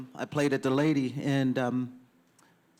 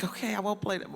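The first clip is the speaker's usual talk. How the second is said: higher-pitched mock compliance; imitating a placating okay; retold hasty concession; 7 semitones higher, 3 dB quieter, faster than usual. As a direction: give in to the boss, lightly acted. Retells giving in to program director.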